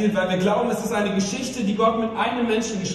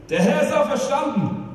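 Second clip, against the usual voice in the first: raised, ringing call to the congregation; Easter proclamation; 6 semitones higher than usual. Real, not acted. Preacher calling the Easter greeting to the congregation, proclaiming. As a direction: proclaim it high for all to answer.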